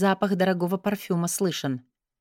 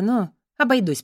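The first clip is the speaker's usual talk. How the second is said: plain reading voice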